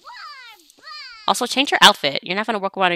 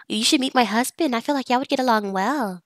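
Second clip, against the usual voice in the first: lightly voiced chatty friend, higher pitch; a hint of sociable small talk, pitched up; friendly chatter; 7 semitones higher than usual. Woman suggesting friend meet her husband, friendly chatter. Voicing a chatting game character.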